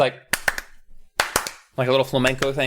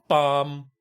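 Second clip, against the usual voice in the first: ordinary talk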